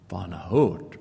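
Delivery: normal talking voice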